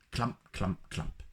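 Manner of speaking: plain reading voice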